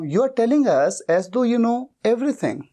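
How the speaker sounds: very slow, each word spelled out for students; slow dictation. Shown as a demonstration. Teacher slowly dictating the English sentence to learners. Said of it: "मैं as though भी कह सकता हूं".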